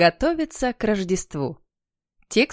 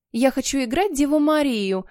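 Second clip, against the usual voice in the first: put-on higher-pitched girlish clamour; girls' eager cry; 5 semitones higher than usual. A narrator's reading. All the girls clamour for a part, eager cry. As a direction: call out like eager schoolgirls. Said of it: "закричали разом все девчонки".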